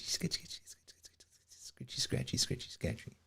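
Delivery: whispered, trailing off to nothing; fading whisper. Pretend. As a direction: let the word dissolve into a whisper. Repeating word, fading into whisper.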